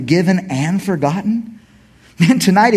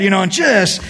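ordinary talk, nothing marked

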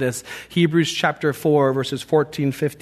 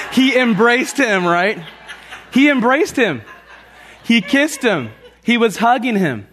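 laughing, high and louder; 8 semitones higher, 4 dB louder than usual. Real, not acted. Preacher laughing to the congregation about the embrace. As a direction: laugh through it with pure joy. Joyful retelling of father's embrace.

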